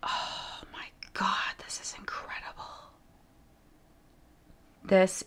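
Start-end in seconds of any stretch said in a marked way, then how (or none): 0.0-2.7 tearful whisper. Real, not acted. Seeing the pug portrait, whispering through tears. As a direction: breathe it out, overwhelmed.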